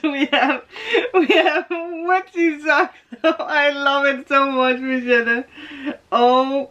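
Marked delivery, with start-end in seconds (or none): 0.0-2.9 laughing. Real, not acted. Unwrapping socks, laughing.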